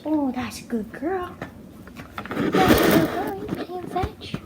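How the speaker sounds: squeaky high praise for the dog. Real, not acted. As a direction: gush over the dog.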